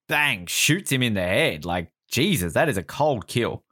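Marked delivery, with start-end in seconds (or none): none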